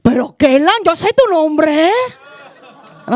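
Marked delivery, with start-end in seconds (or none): 0.0-1.9 louder, insistent grandma in Spanish; a hint of a stubborn Spanish-speaking abuela. Grandma insisting she knows the name, stubborn and louder. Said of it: "And she'll be like".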